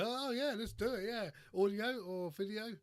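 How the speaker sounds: high-pitched, over-keen imitation; mock eagerness. Imitating the host agreeing to a fake podcast, mock eager. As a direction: mimic a gullible host jumping at it.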